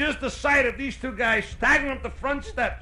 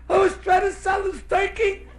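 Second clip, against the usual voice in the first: put-on high-pitched child's confession; high, urgent kid voice; confessing kid; 11 semitones higher, 3 dB louder than usual. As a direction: plead your case to the priest. Kid blurts out confession to priest.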